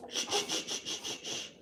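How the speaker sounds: soft unvoiced shushing. Real, not acted. Hushing crying baby.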